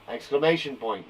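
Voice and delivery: ordinary talk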